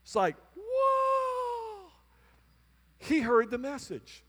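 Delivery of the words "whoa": long, high-pitched whoa; amazed whoa. Retold or imitated. Pastor amazed by the new pianist's playing, awestruck high whoa. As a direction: draw out a high, wowed cry.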